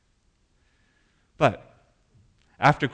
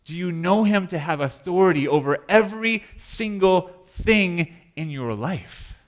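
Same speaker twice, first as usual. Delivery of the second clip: preaching at full force; 6 semitones higher, 4 dB louder than usual. Real, not acted. Repeated preaching climax.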